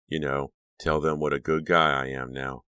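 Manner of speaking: usual speaking voice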